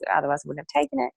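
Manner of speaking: ordinary talk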